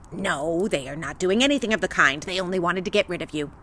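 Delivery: low, quick scornful witch; old witch voice. Acted. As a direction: snap it back like a scornful hag.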